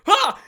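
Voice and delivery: short, very high, loud shriek; horrified scream. Acted. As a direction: scream out in shock. NPC screams at melting face.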